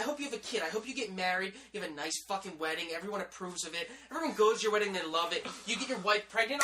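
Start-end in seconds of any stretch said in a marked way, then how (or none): none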